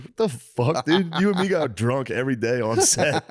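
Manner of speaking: high-pitched, protesting disbelief; mock-outraged disbelief; incredulous retort. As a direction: call out a buddy's nonsense, voice jumping up. Acting out his incredulous retort.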